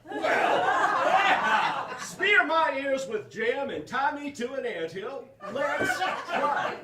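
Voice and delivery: bubbling over with glee; giddy laughing excitement. Real, not acted. Accepting a spot in the show, laughing and shouting.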